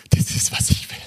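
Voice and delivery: breathy, voiceless declaration; hushed vow. Real, not acted. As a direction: say it as a whispered private resolve. Preacher's personal vow after verse.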